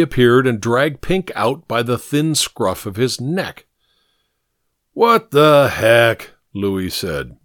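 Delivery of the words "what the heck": loud, slow, drawn-out indignation; exasperated outburst. A narrator's reading. Louis catching Pink in the car, loud exasperation. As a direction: stretch each word out loudly in disbelief.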